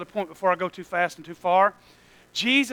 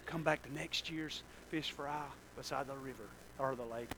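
soft, much quieter, easing them home; calm a crowd down kindly; gentle dismissal; 3 semitones lower, 10 dB quieter than usual. Retold or imitated. Jesus quietly sending the full crowd home.

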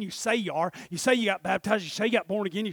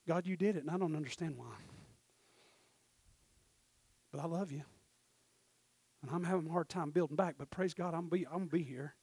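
humble honest prayer; 2 semitones lower, 7 dB quieter than usual. Shown as a demonstration. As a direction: pray it quietly, pausing before the harder admissions. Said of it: "you say it like this".

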